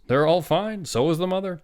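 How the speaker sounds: loud, high, upbeat report; hearty good news. A narrator's reading. Doctor tells father all are fine, hearty.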